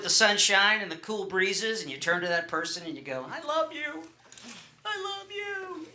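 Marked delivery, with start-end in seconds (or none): 3.3-5.9 high, soft, lovestruck coo; hammed-up tender little high voice, softly; tender love confession. Lover on a picnic blanket confessing love, tenderly. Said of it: "feeling that special warmth".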